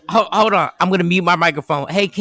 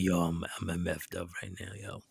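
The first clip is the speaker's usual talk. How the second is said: low, soft, slurred sleepy mumble; groggy drugged voice; 11 semitones lower, 18 dB quieter than usual. Retold or imitated. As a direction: sound doped up on painkillers. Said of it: "He was like".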